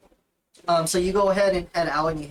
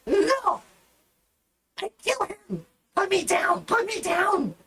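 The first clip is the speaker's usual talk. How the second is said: high, squealing goblin kicking; 14 semitones higher, slower than usual. Acted. Goblin girl kicking while being grabbed, high squeal. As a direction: thrash and squeal to be put down. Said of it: "she's just, like, kicking".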